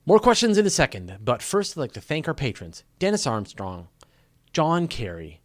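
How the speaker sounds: upbeat sign-off read to the audience; announcer outro. Acted. Host wrapping the live show for viewers, upbeat announcer read.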